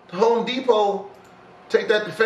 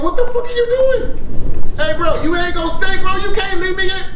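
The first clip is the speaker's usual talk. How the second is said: loud, high shout after a coworker; 11 semitones higher, 8 dB louder than usual. Acted. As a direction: yell at the guy who's leaving, louder and higher. Coworker abandons him mid-shift.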